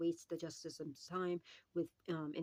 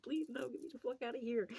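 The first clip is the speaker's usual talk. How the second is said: pleading demon; 8 semitones higher than usual. Retold or imitated. Demon begging to get out.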